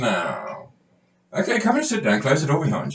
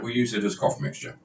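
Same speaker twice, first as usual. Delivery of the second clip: ordinary talk, nothing marked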